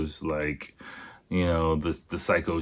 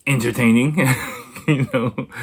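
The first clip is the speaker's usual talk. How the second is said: laughing; 6 semitones higher, 4 dB louder than usual. Pretend. Laughing end of mock aside.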